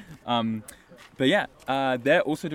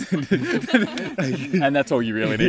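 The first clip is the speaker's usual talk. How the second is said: laughing; 5 dB louder, much slower than usual. Real, not acted. Laughs describing friend.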